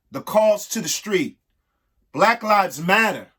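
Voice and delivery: measured, sing-song reading of an alphabet rhyme; rhyming read-aloud. Acted. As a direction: read it like a picture book for kids. Reads an ABC poem aloud.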